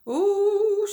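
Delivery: high frightened squeal; fearful whimper. A narrator's reading. Caroline scared as the wagon lurches, whimpering. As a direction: let out a scared little ooh. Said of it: "she whimpered with fear".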